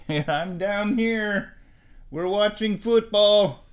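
high, slow cooing voice; pet talk to cat. Real, not acted. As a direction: answer the cat sweetly. Calling to the cat upstairs.

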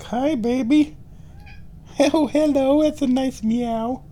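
very high, cooing greeting. Real, not acted. Vlogger greeting returning cat, high baby talk. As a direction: coo high at a beloved cat.

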